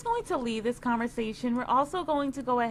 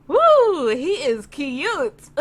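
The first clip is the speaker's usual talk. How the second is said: excited woo; 3 semitones higher, 10 dB louder, much slower than usual. Real, not acted. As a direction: cheer about how cute he is. Gushing over cute guy.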